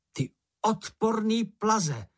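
high, slow, mock-furious name-calling; storybook taunting, drawn out and high; hollering insults. A narrator's reading. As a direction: hurl insults to provoke the monster. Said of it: "zahulákal Mumínek".

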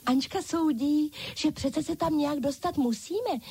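high-pitched chorus of dolls; dolls crying out. A narrator's reading. Other dolls relay their leader's judgement, high-pitched exclaiming. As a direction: echo the leader in a high doll voice. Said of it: "zvolali všechny ostatní panenky".

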